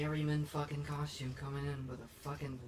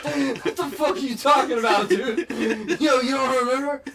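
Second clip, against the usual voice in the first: loud, high, laughing dealer; excited dealer voice; 10 semitones higher, 14 dB louder than usual. Pretend. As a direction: shout it like a pushy street dealer. Playing the meat dealer.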